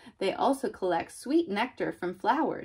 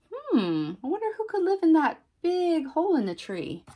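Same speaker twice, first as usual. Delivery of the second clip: high-pitched, quick, playful puzzlement; wondering aloud; 8 semitones higher, 3 dB louder than usual. Acted. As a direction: wonder out loud for little listeners. Wondering aloud to young children.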